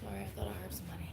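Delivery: fast, hushed whisper. Real, not acted. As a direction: whisper it quickly, caught off guard. Whispering suddenly, quick apology.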